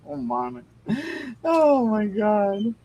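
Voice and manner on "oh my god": amazed. Real, not acted. Laughing at the typo.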